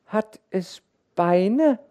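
hohe, laute Kinderstimme, sehr langsam; fragendes Kind. A narrator's reading. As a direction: naiv und gedehnt fragen. Kind fragt nach dem Meer. (kindliche Frage)

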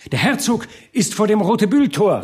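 high, urgent announcement; calling out. A narrator's reading. Newly arrived burghers announce the duke, calling out. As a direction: cry the news to the crowd. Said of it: "riefen sie".

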